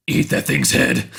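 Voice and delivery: deep, gravelly symbiote voice; Venom. Retold or imitated. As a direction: growl it low and forceful, imitating a monster. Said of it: "in that deep, gravelly voice".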